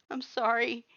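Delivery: high, tearful apology; girl sobbing into Mutt's sleeve. A narrator's reading. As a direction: choke out the apology through tears. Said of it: "you sobbed into his sleeve".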